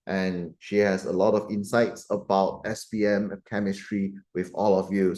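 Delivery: ordinary talk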